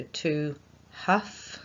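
"to huff" stretched out slowly; slow dictation. Shown as a demonstration. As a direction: dictate word by word. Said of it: "we're gonna write again".

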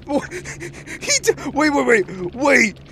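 loud alarmed wait. Real, not acted. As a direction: urgently call for a pause. Streamer alarmed by the killer.

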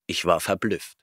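ordinary delivery, nothing marked

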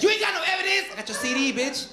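high, indignant mock rapper; rapper protesting. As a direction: squeal back at the cop. Rapper and cop exchange.

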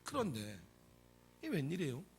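usual speaking voice

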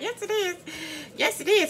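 high mock mom voice; imitating mom. Daughter mimicking her mom complaining about spicy cabbage, high mock voice. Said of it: "But she be like".

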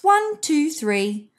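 plain reading voice

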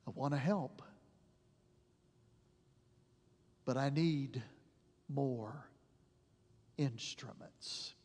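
sorrowful divine reply; hushed, grieving voice; God with tears. Pretend. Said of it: "looks at us with tears in his eyes".

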